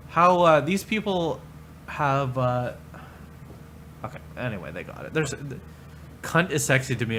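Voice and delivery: normal talking voice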